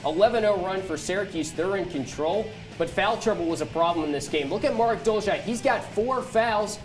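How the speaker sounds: normal talking voice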